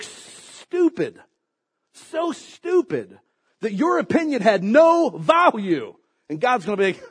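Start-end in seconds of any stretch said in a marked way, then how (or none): 0.0-3.2 mocking emphasis. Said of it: "mocking emphasis".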